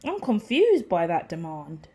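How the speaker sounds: ordinary delivery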